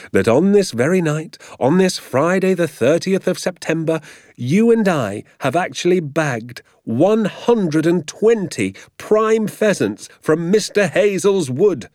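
gleeful dad counting his haul, slowed for effect; excited father. A narrator's reading. Father boasting to Danny about the pheasants, lightly voiced glee.

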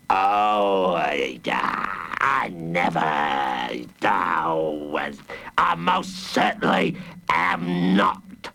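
low, halting monster bluster; low mock shout, no real volume; protesting villain. A narrator's reading. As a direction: protest in a deep, drawn-out brute's voice. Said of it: "shouted the hooded Fang".

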